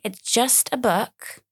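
said slowly, word by word; slow reassurance. Shown as a demonstration. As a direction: drag it out to calm yourself. Repeats line to calm herself.

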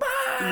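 very high excited scream; thrilled shriek. Retold or imitated. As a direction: shriek with joy at the trucks. Son thrilled by trucks.

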